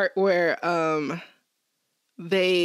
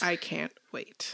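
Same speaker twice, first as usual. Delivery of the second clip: hushed anticipation; 4 semitones lower, 10 dB quieter, slower than usual. Real, not acted. Savouring plans to make popsicle houses.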